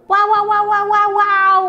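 loud, high cheering "wow, wow"; excited wows. Real, not acted. Cheering the skating toy after its flip, excited. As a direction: cheer the figure on.

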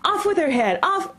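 high, furious scream; screamed order. A narrator's reading. Queen raging at Alice, screamed high.

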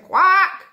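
loud, high quack; one big duck call. A narrator's reading. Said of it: "said the duck".

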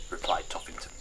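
usual speaking voice

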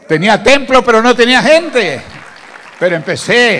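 high, very loud proclamation; full-force pulpit cry; preacher's shout. Real, not acted. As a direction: shout it out to the whole congregation. Preacher bursts out to congregation.

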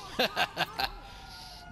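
high cackling laugh; mean, high-pitched giggle; gleeful cackle. Pretend. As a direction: cackle at your victim. Laughs after taunt.

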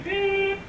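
high imitated answering machine beep; mock answering machine beep. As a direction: chirp it like a machine.

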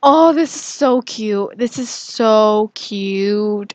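real squee over something cute; gushing. Real, not acted. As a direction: gush with a long, stretched-out "so cute". Gushes when the hug is accepted.

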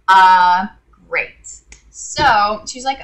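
normal talking voice

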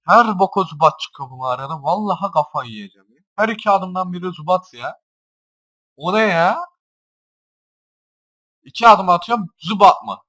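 high and loud throughout; exasperated rant. Real, not acted. Gamer ranting at the cave's endless Zubats, exasperated. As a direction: rant at the screen.

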